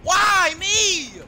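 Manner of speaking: high, loud cry of dismay; panicked shout. Real, not acted. Gamer caught by the killer, shouting in panic. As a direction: yell it out as you get caught.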